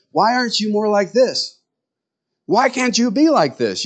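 high, whiny nagging question; mock complaining husband. Husband complaining to his wife, high mock whine. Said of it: "complaining about it and going".

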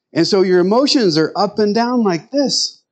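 normal talking voice

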